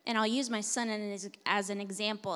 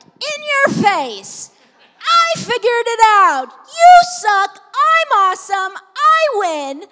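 loud, much higher taunting crow; gloating; 13 semitones higher, 20 dB louder, slower than usual. Retold or imitated. Mother voicing her son gloating over the gate, loud and taunting. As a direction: crow it like a smug little winner. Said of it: "It was like".

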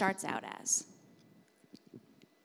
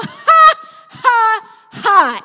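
shouted, much higher ha ha; forced dry laugh; 13 semitones higher, 22 dB louder, much slower than usual. Shown as a demonstration. Preacher demonstrating dry laughter to the congregation, shouted. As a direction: belt out a laugh you don't feel. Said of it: "but you just go".

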